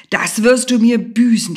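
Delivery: yelled in fury; furious shouting. A narrator's reading. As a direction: shout it as a threat. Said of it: "schrie er".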